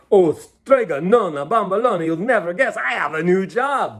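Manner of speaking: loud, bright calling out with joy; eager young man shouting his news; excited shout. A narrator's reading. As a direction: burst in shouting happy news. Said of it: "Big Anthony shouted out".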